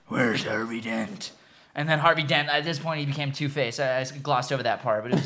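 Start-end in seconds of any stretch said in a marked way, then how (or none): 0.1-1.3 very low, slow, soft growl; Batman. Retold or imitated. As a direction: demand it slowly in a deep voice. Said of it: "And then Batman's like".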